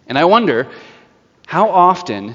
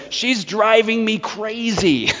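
much higher, slow fed-up whine; exasperated judge; 9 semitones higher, slower than usual. Retold or imitated. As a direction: voice a judge at his wit's end. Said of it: "the text says".

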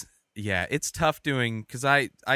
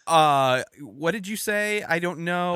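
higher, drawn-out bewildered reply; acted confusion; 6 semitones higher, 4 dB louder, slower than usual. Customer who can't hear the fraud line, confused. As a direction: play straining to hear on a bad line. Acting out straining to hear operator.

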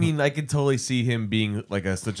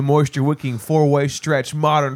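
mock ad read; 6 semitones higher, 5 dB louder than usual. Parodies the polo ad copy.

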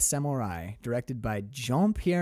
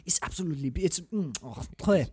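put-on soft, murmuring French accent; put-on French accent, savoring the words; 4 dB quieter than usual. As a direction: rhapsodize quietly like a French cinephile. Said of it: "I base my French accent off of".

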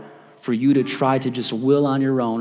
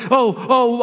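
high, breathless fan squeal; super excited; 8 semitones higher, 3 dB louder than usual. Retold or imitated. Disciples meeting their heroes, excited squeals. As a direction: squeal like meeting your hero. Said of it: "they're just, like, super excited".

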